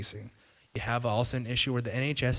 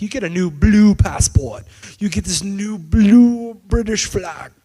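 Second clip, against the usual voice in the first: higher, much louder salesman boast; hammed-up campaign pitch; mock campaign hype; 7 semitones higher, 10 dB louder, slower than usual. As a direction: sell it like a game show giveaway. Boris campaign boast voiced.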